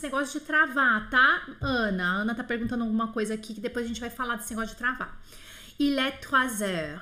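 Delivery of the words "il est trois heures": "trois heures" linked slowly with a z sound; slow, careful "Il est trois heures"; teacher modelling the liaison slowly. Shown as a demonstration. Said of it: "eu tenho que juntar o número".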